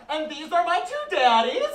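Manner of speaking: put-on bright kid voice showing off her dads; proud little girl. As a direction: introduce your two daddies proudly like a kid. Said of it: "they'll be like".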